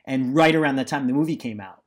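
ordinary talk

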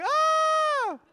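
long, very high loud shriek; mimicked squeal of panic, held out; girlish scream. Owner reliving dog catching a squirrel, girlish scream. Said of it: "I was screaming like a girl".

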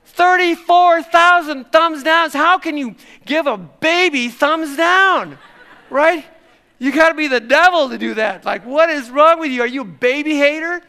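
high, loud hammed-up indignation; mock outrage. Pastor mocking thumbs-down voters of baby videos, hammed-up outrage. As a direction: play up being shocked at baby haters. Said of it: "I'm kind of making fun of this".

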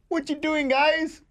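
very high-pitched mock holler; imitated uncle's yell. Uncle to the sleeping family at six thirty, imitated high yelling. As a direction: call out high to wake everyone. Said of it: "he's yelling out loud".

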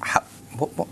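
whispered, soft puzzled question; confused whisper. Retold or imitated. Visitor quietly asking what the argument was about, whispered. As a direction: hush it, baffled, to the person beside you.

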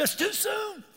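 very high, squeaky mock wail; hammed-up, choked-up crying voice; mock tearful. Husband still upset an hour later, mock tearful.

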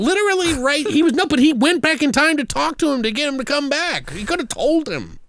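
very high-pitched exasperation; incredulous rant. Real, not acted. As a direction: squeal the complaint in disbelief. Incredulous rant about plot.

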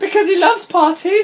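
very high, rising silly voice; mocking squeaky read. Reader answering why he would come, squeaky mock voice. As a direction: chirp it up like a daft question.

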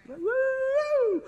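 high, loud joyful whoop; excited whoop. Real, not acted. Preacher whooping over the good news, high and loud. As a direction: let out a happy church whoop.

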